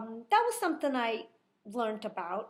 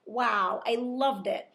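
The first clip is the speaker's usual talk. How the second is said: ordinary talk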